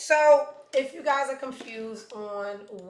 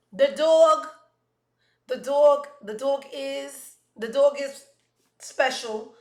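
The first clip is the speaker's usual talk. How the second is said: mock tactful hesitation; 6 semitones higher, 9 dB louder, slower than usual. Real, not acted. Host hedging about ugly dog.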